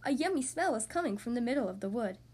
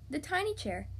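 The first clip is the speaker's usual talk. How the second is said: ordinary delivery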